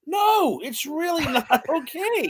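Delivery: high, drawn-out protest; incredulous objection. Real, not acted. Rebutting the cannibal movie, incredulous objection. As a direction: push it up high, stretching it out. Outraged rebuttal to film's message.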